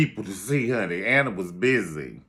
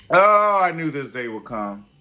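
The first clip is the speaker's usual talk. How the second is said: dramatic dread; 3 semitones higher, 8 dB louder than usual. Real, not acted. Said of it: "Oh, Lord, I dreaded this day".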